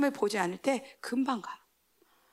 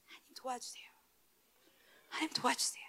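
barely voiced, much softer plea; whispered prayer; 13 dB quieter than usual. Shown as a demonstration. Believer quietly begging God for help, whispered. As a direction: breathe the prayer almost silently.

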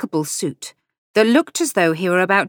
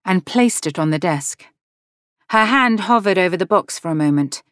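ordinary delivery, nothing marked

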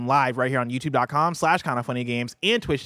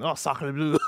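put-on French accent; slower than usual. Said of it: "That's my French laugh".

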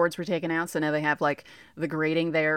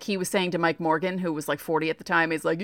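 ordinary delivery

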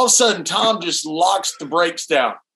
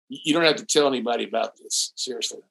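hushed, lower voice pleading for secrecy; quiet plea; 9 semitones lower, 7 dB quieter than usual. Retold or imitated. Dutch leaning over, begging Tom to keep quiet, hushed. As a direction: mutter it low so nobody hears. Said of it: "he goes".

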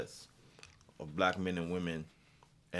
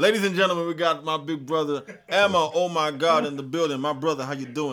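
hype announcer; 6 semitones higher, 11 dB louder than usual. Acted. Host hypes guest loudly.

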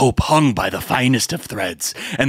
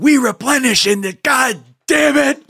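playful dad yell; 4 dB louder than usual. Retold or imitated. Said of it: "He's like".

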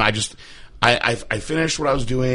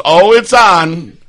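knowing drawn-out tease; 7 semitones higher, 10 dB louder, much slower than usual. Retold or imitated. Lady confirms, amused.

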